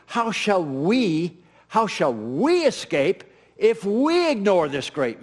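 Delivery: "we" leaned on in both repeats, slow; slow preaching stress on "we" in "how shall we escape". Shown as a demonstration. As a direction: punch "we" each time, measured pace. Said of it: "The pronoun is we".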